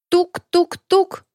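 slow, high knock-knock-knock; knocking imitation. A narrator's reading. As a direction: tap out the knocks slowly. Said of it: "продолжал стучать механоид".

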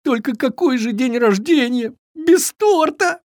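high-pitched whimpering lament; tearful fawn. A narrator's reading. Fawn mourns a birthday without cake, whimpering. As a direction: wail it high, near tears. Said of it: "всхлипнул Триша".

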